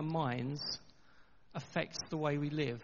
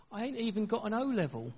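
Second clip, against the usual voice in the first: higher-pitched East End protest; East End accent; 6 semitones higher than usual. Retold or imitated. Church leader doubts himself before his Oxford address, East End accent. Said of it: "In his East End accent".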